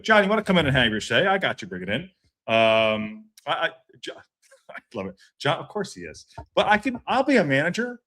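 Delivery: usual speaking voice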